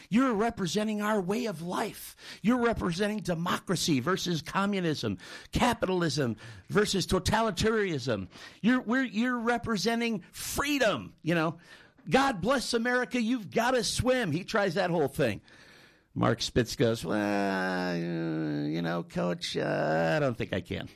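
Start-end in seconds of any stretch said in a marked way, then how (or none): 0.1-14.3 high-pitched patriotic exhortation; rally him as if waving the flag in his face; hammed-up patriotic coach speech. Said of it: "tries waving the flag in his face". 17.1-20.9 long drawn-out hesitant hemming; mock reluctant swimmer. Swimmer balks again after patriotic speech.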